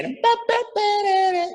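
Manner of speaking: very high brassy syllables; imitated trumpet riff. Shown as a demonstration. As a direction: punch out a high horn lick. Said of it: "was the trumpet".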